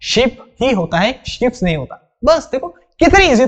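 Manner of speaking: ordinary talk, nothing marked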